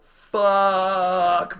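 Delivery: long, loud, high yelled curse; attempted scream. Jumper trying to scream in free fall, long yell. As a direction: scream it out with full force. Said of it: "I try to scream".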